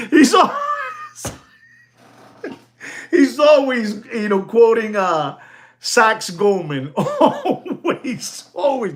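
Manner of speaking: high laughing, words broken by laughter; laughing hard. Real, not acted. Mocking the rival YouTuber, laughing high.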